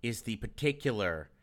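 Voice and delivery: very low, soft pronouncement; mock-solemn lore voice. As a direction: explain it deep and hushed like ancient lore. Low nerdy lecturing voice.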